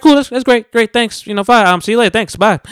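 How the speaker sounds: high, loud imitation of her goodbye; mock polite brush-off. She brushes him off at the car, mock polite brush-off. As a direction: imitate a hurried, high-pitched thanks-and-bye.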